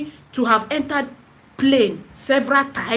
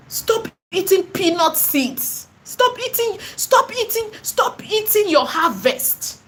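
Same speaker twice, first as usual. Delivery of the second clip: pitched high, calling out "stop eating" over and over; urgent high plea; 6 semitones higher, slower than usual. Real, not acted. Seller scolding viewers who scam customers, urgent high-pitched plea. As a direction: cry it out high, begging them to stop.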